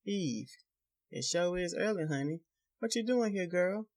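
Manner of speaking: a greasy preacher sweet-talking her; sly preacher. A narrator's reading. Preacher greets the girl at his door, sly and oily. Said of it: "Preacher asks her".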